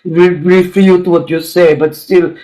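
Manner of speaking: put-on slow, comic Russian accent; deliberate Russian-accented English; mock Russian accent. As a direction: play a Russian club regular. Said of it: "especially if you're Russian".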